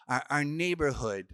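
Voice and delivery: ordinary talk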